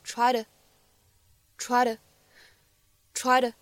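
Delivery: "try to" linked, the t of "to" a quick flap; American flap T. Shown as a demonstration. Teacher showing American pronunciation, "try to" with flap T. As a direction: say "try to" almost like "try-duh". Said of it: "美式发音当中独有的flap T".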